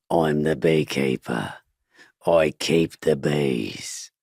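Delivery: slow, drawn-out action-star impression; Jason Statham. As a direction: do a hard-man movie star, slow and deliberate. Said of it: "I did Jason Statham sounding like".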